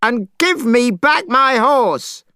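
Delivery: very high, slow loud challenge; challenging shout. A narrator's reading. Monkey challenging the dragon, shouting. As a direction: dare the dragon to fight. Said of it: "shouted Monkey".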